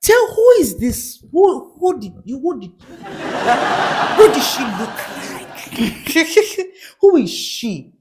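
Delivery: high mock-mom interrogation; imitated mom digging for details; inquisitive mother. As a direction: press for gossip as a nosy mother. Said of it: "She's like".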